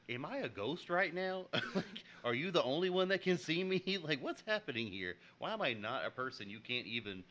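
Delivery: high, loud exasperated rant; mock indignation. Server ignored by the customer, mock indignant protest.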